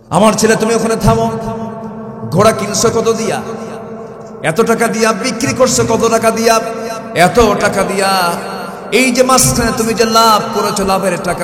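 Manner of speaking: high, loud stern father's rebuke; stern caliph scolding son. Acted. Omar stopping his son at the door, loud stern rebuke. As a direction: call the son to account loudly. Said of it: "বলছে".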